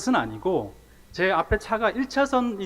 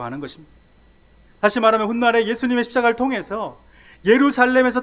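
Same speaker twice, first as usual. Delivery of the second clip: raised, loud pulpit delivery; soaring sermon voice; fervent preaching; 6 semitones higher, 7 dB louder than usual. Acted. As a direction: proclaim it to the whole congregation. Preaching the passage at full force.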